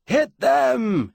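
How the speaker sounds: drawn-out battlefield command; harsh commanding shout. A narrator's reading. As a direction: shout it as a harsh order to charge. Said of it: "it shouted, harsh with command".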